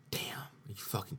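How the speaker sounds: breathy disbelieving whisper; whispered inner reaction. Retold or imitated. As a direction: hiss it under your breath in shock. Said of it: "I was like".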